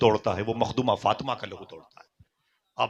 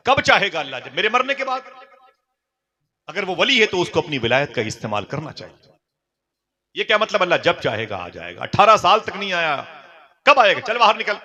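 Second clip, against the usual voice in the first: high, loud mocking rant; enraged wife throwing her husband out; furious shout; 8 semitones higher, 8 dB louder than usual. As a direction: scream the taunt at him. Wife's angry rant.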